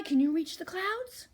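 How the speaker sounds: plain reading voice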